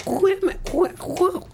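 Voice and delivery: very high squeaky cartoon voice. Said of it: "I'm not doing it in this voice".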